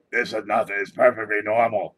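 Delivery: a hint of a loud old grandma insisting all is fine. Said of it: "they sound like this".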